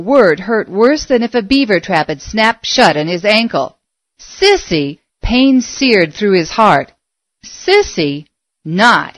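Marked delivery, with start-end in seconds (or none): none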